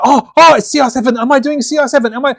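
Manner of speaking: high, loud, eager voice; playing the thrilled voice actor asking about his gig; mock excited actor. A voice actor asking if it's his game, high and eager. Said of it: "I'm like".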